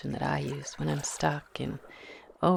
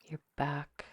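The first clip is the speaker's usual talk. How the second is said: guided meditation; 5 dB quieter than usual. Acted. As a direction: ease the listener into calm, one breath at a time. Leads a guided visualization.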